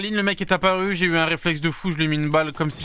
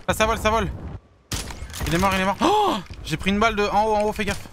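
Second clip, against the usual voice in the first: panicking gamer; 7 semitones higher, faster than usual. Real, not acted. Under fire, calls kills.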